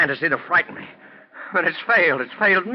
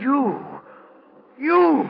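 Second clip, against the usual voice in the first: sudden high, loud outburst; husband recoiling in disbelief; shocked cry; 12 semitones higher, 3 dB louder than usual. Acted. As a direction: cry out in horror. Husband realizes who she is.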